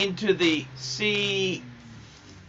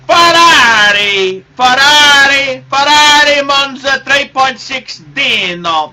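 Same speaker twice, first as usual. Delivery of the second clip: excited shout; 5 semitones higher, 10 dB louder than usual. Real, not acted. Naming his dream car with gusto.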